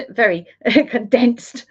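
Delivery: amused, laughter breaking into the phrase; laughing. Real, not acted. Speaker tickled by describing humans as very condensed, laughing. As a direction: chuckle between the words.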